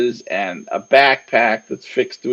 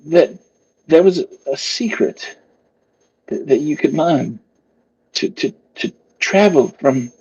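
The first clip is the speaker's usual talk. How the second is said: higher, halting, stammering voice; hesitant maker; 5 semitones higher, faster than usual. Acted. Maker reluctantly telling the cloaked stranger a secret, high and hesitant. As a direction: let the secret out haltingly, pitched up.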